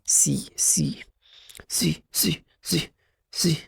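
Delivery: S said as a hissing "سي" sound, drawn out; teacher sounding out "سي" slowly; phonics sound for S. Shown as a demonstration. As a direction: say "سي" as the bare letter sound. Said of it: "بتنطق ازاي؟".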